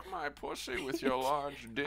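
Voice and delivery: mock sultry voice. Dirty talk in accent joke.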